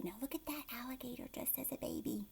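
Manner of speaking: quick excited whisper. Real, not acted. Teacher whispering to kids about the picture, quick whisper. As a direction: lean in and whisper the aside.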